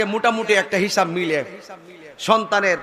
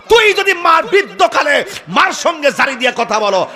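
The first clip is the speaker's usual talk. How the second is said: loud, high scolding shout; angry rebuke; 9 semitones higher, 6 dB louder than usual. Acted. Preacher shouts at a son who is rude to mother. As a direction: berate the son at full force.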